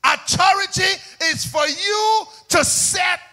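high, loud pulpit shout; preaching at full force. Real, not acted. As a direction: proclaim it to the whole church at full volume.

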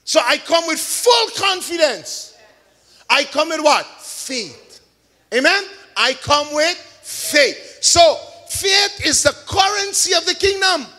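building, calling preacher's cadence; fervent sermon delivery; preaching. Acted. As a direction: preach it to the congregation. Preaching the faith message.

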